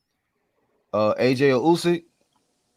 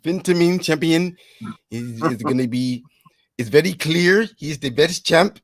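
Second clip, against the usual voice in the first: put-on higher, slow Spanish-accented announcer; drawn-out mock Spanish accent, pitched up; 6 semitones higher, 3 dB louder than usual. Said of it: "don't do a Spanish accent".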